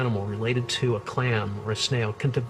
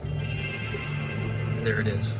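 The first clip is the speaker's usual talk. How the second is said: hushed; 3 semitones lower, much slower than usual. Real, not acted. Reveals hidden octopus on video.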